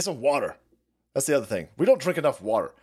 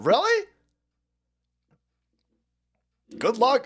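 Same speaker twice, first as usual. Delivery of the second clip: sarcastic scoff; 10 semitones higher, 4 dB louder than usual. Retold or imitated. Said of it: "I'm sitting there going".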